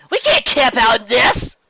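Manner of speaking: high, loud and slow outburst; loud, high, exasperated cry; loud protest. Acted. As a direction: refuse it over the rain. Rainbow protests in the storm.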